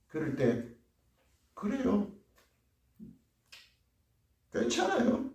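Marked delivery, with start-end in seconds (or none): 1.5-5.3 high, soft brush-off; dismissive shrug. Acted. Stubborn believer brushing off God's counsel, dismissive and soft. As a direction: wave it away lightly.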